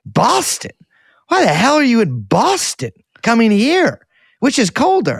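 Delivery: high-pitched, disbelieving retold outburst; incredulous. Retells own incredulous reply to stranger.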